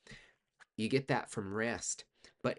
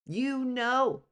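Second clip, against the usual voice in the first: high emphasis; 14 semitones higher, 9 dB louder than usual. Acted. Repeats emphatic point.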